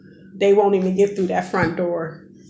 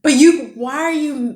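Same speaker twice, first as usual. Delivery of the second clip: agitated; 7 semitones higher, 5 dB louder than usual. Retold or imitated. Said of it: "starting to feel guilty".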